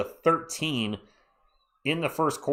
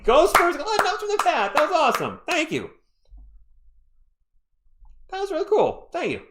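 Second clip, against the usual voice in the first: high, excited gush of praise; delighted cheering; 5 dB louder than usual. Real, not acted. Streamer thanking a viewer for a rhyme, delighted cheering. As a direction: squeal your thanks in a high, thrilled voice.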